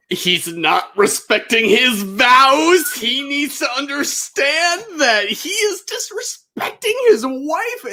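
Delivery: high, slow mock-indignant mom voice; outraged mom impression. Imagining a mom defending marriage vows, mock indignation. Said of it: "your mom would have the integrity".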